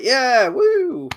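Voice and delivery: high, loud celebratory whoop; yeah-woo with a rising, loud whoop; mock cheering. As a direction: cheer like a fan at a win. Imitating viewer cheering a win.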